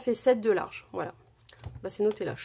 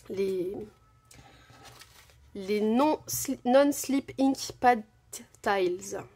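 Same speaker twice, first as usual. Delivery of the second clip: slow, self-mocking English accent on the label; much slower than usual. Shown as a demonstration. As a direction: read the product name in a hammed-up English accent. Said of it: "J'ai un super accent anglais".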